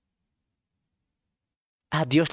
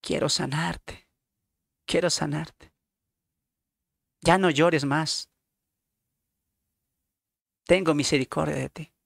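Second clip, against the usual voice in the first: Jesus comforting. Acted. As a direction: speak gently to a weeping man. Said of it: "Y Jesús le dice".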